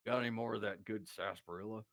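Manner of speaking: low cowboy impression; Sam Elliott. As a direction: drop it low like an old movie cowboy. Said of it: "Sam Elliott, the".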